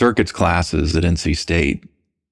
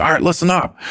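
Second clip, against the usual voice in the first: higher-pitched mock military call to attention; military instructor; 9 semitones higher than usual. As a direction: imitate a commander calling the lab to order. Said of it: "he acted just like he was in the military".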